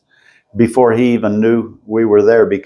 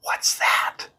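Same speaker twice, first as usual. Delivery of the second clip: breathy unvoiced whisper; 10 dB quieter than usual. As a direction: whisper it as if not to be heard. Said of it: "I hear him whisper".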